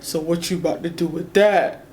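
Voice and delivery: understated imitation of a man daring her; lightly voiced boyfriend. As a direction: drop the voice a touch for the boyfriend. Boyfriend's retort voiced in story.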